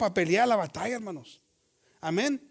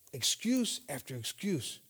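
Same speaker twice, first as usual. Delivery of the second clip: usual speaking voice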